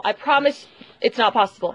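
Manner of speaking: normal talking voice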